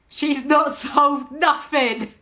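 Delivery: loud, drawn-out mock outrage; mock-dramatic. Repeats line mockingly, laughing.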